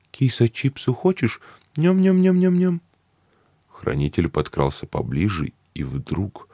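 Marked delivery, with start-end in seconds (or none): 0.1-2.8 put-on high squeaky baby talk to a cat; cutesy baby talk. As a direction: coo at the kitty in baby talk. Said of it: "и сюсюкала при этом".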